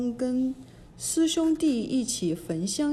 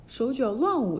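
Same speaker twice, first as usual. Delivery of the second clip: plain reading voice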